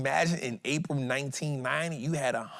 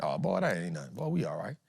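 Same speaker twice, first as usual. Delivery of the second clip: lower, softer, relaxed shrug; unbothered reassurance; 8 semitones lower, 6 dB quieter than usual. Retold or imitated. Imitating a calm trader shrugging off a dip, relaxed. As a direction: brush off the dip, calm and easy.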